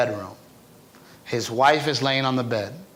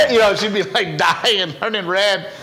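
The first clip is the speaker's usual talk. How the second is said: still laughing; 5 semitones higher, 4 dB louder than usual. Real, not acted. Laughing while describing aunt.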